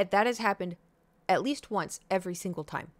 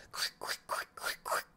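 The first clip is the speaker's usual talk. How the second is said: cat scratching noise; 8 dB quieter than usual. Retold or imitated. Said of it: "just goes".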